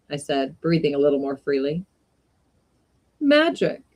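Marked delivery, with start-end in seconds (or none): 3.2-3.8 careless. Acted. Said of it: "said the shopman with a careless wave".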